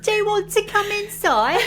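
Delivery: high, loud put-on child voice; hammed-up creepy kid; mock child. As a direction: invite him in sweetly. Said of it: "voiced child invite".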